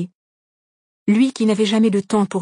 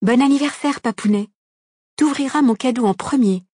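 higher-pitched, eager birthday greeting; excited daughter; 5 semitones higher, 3 dB louder than usual. A narrator's reading. Daughter wishing papounet happy birthday, excited and high. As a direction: call out eagerly to your dad.

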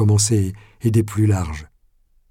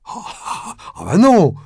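breathy chuckle jumping to a loud, high no; scoffing refusal; 8 semitones higher, 4 dB louder than usual. A narrator's reading. Pradel refusing the mayor's demand, scoffing. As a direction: laugh the demand away.

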